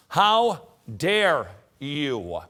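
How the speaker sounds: very slow, loud, outraged how dare you; indignant reproach. Acted. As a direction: draw it out in righteous outrage.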